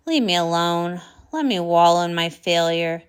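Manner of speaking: put-on low, gloomy old-wizard grumble; sulky old wizard. Old wizard sulking at the curious pony, low and gloomy. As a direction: sink into your own misery.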